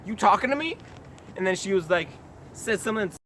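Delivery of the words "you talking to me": high, rising mock disbelief; incredulous retold question. Jogger to a stranger talking loudly behind him, incredulous. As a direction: toss it up high as a puzzled question.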